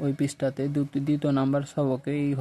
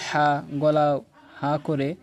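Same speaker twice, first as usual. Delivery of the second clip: normal talking voice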